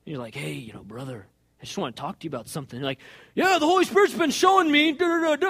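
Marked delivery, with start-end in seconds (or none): 0.3-2.8 low, soft, gentle approach; tender, hushed lead-in to a hard talk; loving correction. Acted. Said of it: "You lovingly". 3.3-5.5 high, loud, gushing reply; excited, high-pitched babbling confession; eager interruption. Acted. Eager believer jumps in confessing.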